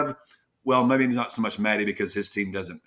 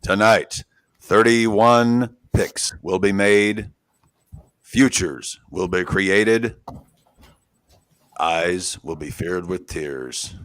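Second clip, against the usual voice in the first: dramatic trailer voice; 5 semitones lower, slower than usual. Acted. Dramatic movie trailer narration.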